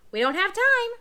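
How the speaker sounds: high, loud squawky parrot. Acted. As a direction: chirp it out like an anxious parrot.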